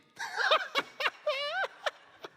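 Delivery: high, loud burst of laughter; big laugh. Real, not acted. Pastor laughing at the mom's threat, big loud laugh. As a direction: crack up at the memory.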